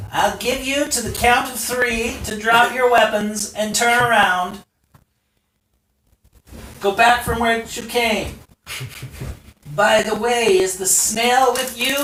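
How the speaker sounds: high, loud threatening commander; ultimatum voice. Acted. Enemy leader orders the townsfolk to surrender, high and loud. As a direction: bark the countdown high and loud. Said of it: "he says".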